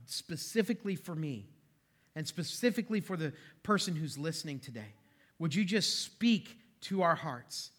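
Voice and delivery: soft, low praying voice with pauses; sincere prayer. Real, not acted. Pastor leading the congregation in prayer, hushed praying.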